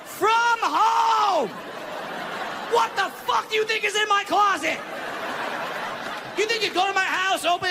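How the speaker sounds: high, loud incredulous outburst; loud mock outrage at the casting note; outraged. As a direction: blow up at the absurd request. Outraged comic rant.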